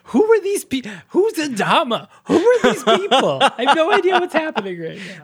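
high, loud mock bewilderment; confused listeners. Host imitating lost listeners who skipped part one, mock bewildered. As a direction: rattle off baffled questions in an exaggerated high voice. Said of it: "that's how you all sound to us".

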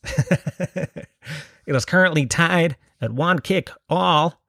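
sotaque americano de repórter, leve, com riso no começo; American reporter voice. Retold or imitated. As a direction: fake American sports reporter, lightly done, laughing at the start. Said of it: "report on, uh, soccer games".

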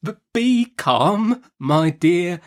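very slow, high, soothing plea; hesitant, gentle coaxing with a stammered start; softest accents. A narrator's reading. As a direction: placate timidly, stretching each word. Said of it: "in his softest accents".

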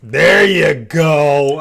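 slow drawn-out cheer; excited cheer. Real, not acted. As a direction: cheer it out loud. Praising a ten rating.

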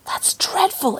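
hushed whisper of "dreadful"; whispered horror. Acted. Lucy whispering her horror at the butcher. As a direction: breathe it out in shock. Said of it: "exclaimed Lucy".

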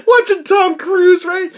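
high, laughing through the words. Real, not acted. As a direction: crack up while saying it. Laughing at himself watching film.

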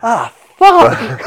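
high, loud exclamation of dismay; mock panicked curse. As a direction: blurt it like the plan just fell apart. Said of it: "And I'm like".

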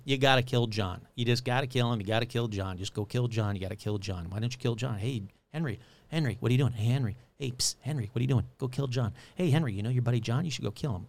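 low, soft coaxing inner voice; voice in his head. Retold or imitated. The voice in Henry's head urging him on, low and coaxing. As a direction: tempt him quietly, like a sly voice inside his skull. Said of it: "a voice in his head wouldn't leave him alone".

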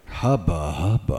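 low lecherous hubba hubba; leering hubba hubba. Pretend. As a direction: growl it like a leering wolf. Leering at women in underwear.

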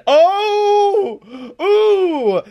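long high-pitched impressed hoot; high drawn-out "oooh". Retold or imitated. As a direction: re-enact a crowd-style "ohh" way up high. Acts out his amazed reaction.

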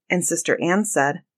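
usual speaking voice

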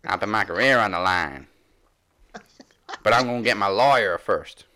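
very loud mock southern accent; Jazz accent. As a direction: proclaim it in a put-on New Orleans accent. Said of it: "That was a little southern accent".

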